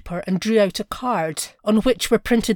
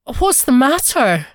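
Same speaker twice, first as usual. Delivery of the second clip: higher, louder, anxious repeat; more alarmed; 5 semitones higher, 5 dB louder than usual. A narrator's reading. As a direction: press the question, more worried. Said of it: "He stuttered".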